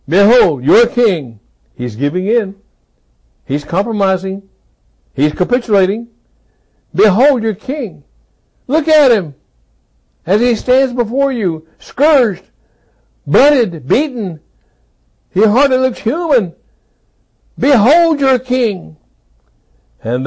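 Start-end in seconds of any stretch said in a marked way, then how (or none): 0.1-1.4 loud proclamation. Acted. Pilate presenting Jesus, loud. 6.9-19.0 much higher, loud, slowing at the end; impassioned proclamation. Acted. Pilate presenting beaten Jesus.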